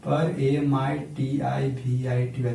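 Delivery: slow spelling of पर एम आई टी आई वी आई टी वाई; spelled out letter by letter. Shown as a demonstration. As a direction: spell पर एम आई टी आई वी आई टी वाई one letter at a time, slowly. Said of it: "परमिटिविटी".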